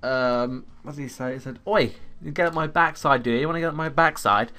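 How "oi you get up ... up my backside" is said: loud, aggressive street taunt; imitating a driver's aggressive shout; aggressive yell. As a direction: snarl it at a passer-by. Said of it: "Like, really aggressively".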